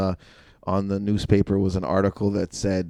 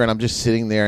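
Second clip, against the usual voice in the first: ordinary talk, nothing marked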